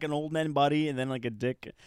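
ordinary talk, nothing marked

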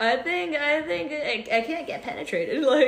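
excited. Real, not acted. Boasting, laughing, loud and high.

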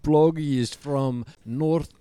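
put-on broken English with a Ukrainian accent; Ukrainian-accented puppet telling his origin. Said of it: "that's the accent".

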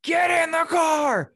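high, loud, drawn-out yell; acted-out emergency holler; panicked shout. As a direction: shout an urgent order across the house. Retells his panicked order to wife.

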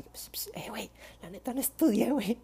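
breathy whisper, barely voiced; whispered plea. Whispering to best friend that she didn't study. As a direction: sneak a confession past the teacher. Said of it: "yo le empezaba a decir así".

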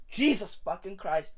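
very soft, low muttered curse; hushed dismay. Acted. Comic despairing at his own family, hushed dismay. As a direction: swear under your breath.

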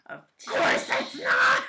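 high, slow, airy exaggerated outburst; fake-agree with the husband in a furious yelp; hammed-up angry wife snapping back; sarcastic mock shout. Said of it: "so mad at him".

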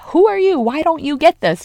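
high, loud, indignant demand; yelling. Acted. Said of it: "like, this yelling thing".

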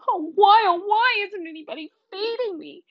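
high, melodramatic wail; hammed-up, high-pitched lament; moaning. A narrator's reading. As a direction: moan like a suffering dog. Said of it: "roll onto my back and moan".

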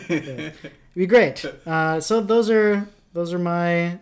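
ordinary talk

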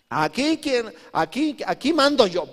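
high, loud bragging Samson; Samson boasting. Retold or imitated. Preacher voicing Samson proclaiming he rules, high and loud. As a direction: crow it out as the boss. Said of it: "Y decía".

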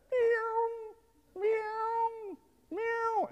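high, thin imitated kitten meows; cat meows. Imitating the kitten meowing from the teacher's desk, high cat mews. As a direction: mew like a small kitten.

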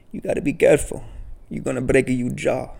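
hammed-up fatherly caution from the old cashier; imitating old Italian deli man. Old deli owner warning about breaking your jaw, mock caution. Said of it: "he's a funny old Italian guy".

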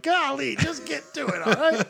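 high cartoon cowboy voice; squeaky hammed-up cartoon cowboy; Yosemite Sam. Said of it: "It was more like Yosemite Sam".

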